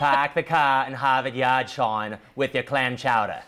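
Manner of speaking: slow, broad Boston accent. Shown as a demonstration. Host showing off to co-hosts, Boston accent. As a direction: drop those R's like a Bostonian. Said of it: "That's Bostonian right there".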